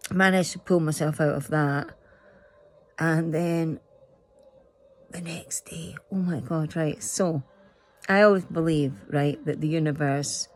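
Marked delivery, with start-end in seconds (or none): 5.1-6.0 whispering. Real, not acted. Whispered aside.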